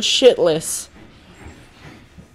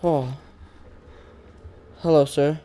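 hushed, lower hello with a rising end; nervous hush; 5 semitones lower, 10 dB quieter than usual. Real, not acted. Streamer nervously greeting the giant in the game, hushed. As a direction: greet him softly and warily.

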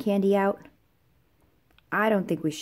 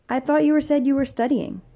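higher, louder challenge; mild reproach; 6 semitones higher, 5 dB louder than usual. Acted. Catching someone who said they were studying, raised-voice reproach. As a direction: call them out, voice raised.

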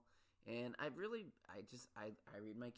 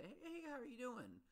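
much higher, singsong imitation of a cheery asker; hammed-up friendly hello, pitched way up; mock chirpy greeting; 13 semitones higher than usual. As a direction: mimic someone breezily asking how you are. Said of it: "if you come up to me and say".

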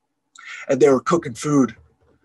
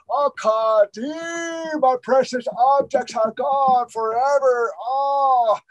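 high, drawn-out wail of loss; old man wailing for his lost treasures; wailing lament; 8 semitones higher, slower than usual. Acted. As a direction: cry out to the sky, grieving.

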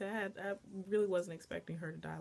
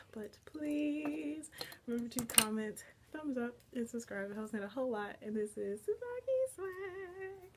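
high, stretched-out vlog outro; singsong sign-off; 5 semitones higher than usual. Real, not acted. Vlogger asking viewers to subscribe, singsong sign-off. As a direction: chant the farewell in a playful lilt.

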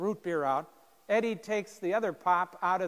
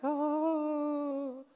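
a high, startled "Oh" like a deer in headlights; stunned little gasp; 9 semitones higher than usual. Retold or imitated. Terrified freshman caught off guard, stunned high oh. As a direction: freeze up and squeak it. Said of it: "just like this".

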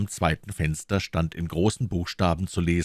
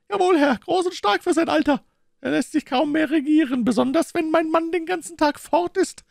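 much higher woman's character voice; talkative boatman's wife; 5 dB louder than usual. A narrator's reading. Mother talks to a gentleman about her son, high wifely chatter.

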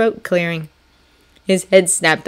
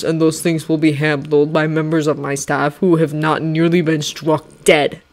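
General Washington. Acted. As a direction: speak like a calm stern general. Washington voiced in story.

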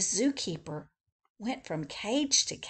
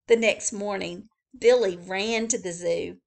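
ordinary delivery, nothing marked

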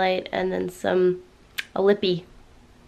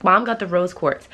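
ordinary delivery

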